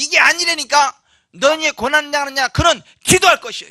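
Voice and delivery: loud, high, emphatic proclamation; full-force preaching. Real, not acted. Preacher quoting scripture to the suffering, forceful. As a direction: refute it, then proclaim the verse.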